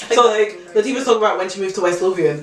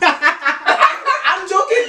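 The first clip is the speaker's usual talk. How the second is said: high, slow laughing protest; 11 semitones higher, much slower than usual. Real, not acted. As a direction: insist through giggles.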